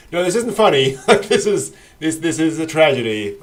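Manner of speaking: loud, high-pitched protest; emphatic, amused correction; mock insistence. Series correcting viewers who chuckled, loud mock insistence. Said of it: "for the series to go".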